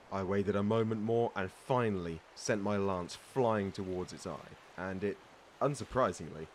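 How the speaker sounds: a pirate spinning a tall tale, with relish; storytelling. Acted. Pirate telling a greenhorn his monster tale with relish. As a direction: build the legend for the listener.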